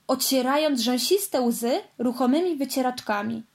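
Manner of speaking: plain reading voice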